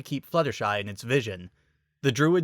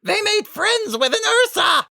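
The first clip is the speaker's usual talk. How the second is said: very high, loud shriek; panicked shout; 6 dB louder than usual. A narrator's reading. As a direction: shriek while fleeing. Werewolf fleeing in panic.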